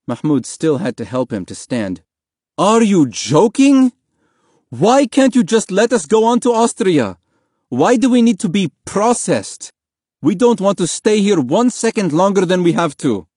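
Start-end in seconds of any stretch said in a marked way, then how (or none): 2.6-13.3 loud, high-pitched incredulous tirade; outraged. A narrator's reading. Father confronting policeman about being held, outraged. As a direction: demand answers, furious and exasperated.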